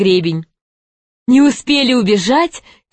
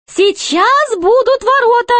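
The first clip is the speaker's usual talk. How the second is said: very high-pitched jester; 13 semitones higher than usual. A narrator's reading. Jester warns his companions of the gates, high jester voice. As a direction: pipe it up high like a court jester.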